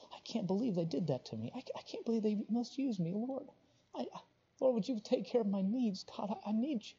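lightly voiced wronged person, pleading; a hint of a wounded soul crying out; aggrieved complaint. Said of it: "they're crying out to God".

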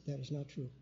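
very soft, barely voiced objection; said softly. Repeating the objection to his wife in church, very softly. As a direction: keep it so low nobody around hears. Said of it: "I said it real softly".

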